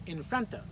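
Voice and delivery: "In front of" with the weight on "front"; second-syllable stress. Shown as a demonstration. Teacher models for learners, stress on "front". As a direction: punch "front", keep "In" and "of" light. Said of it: "Again, second syllable".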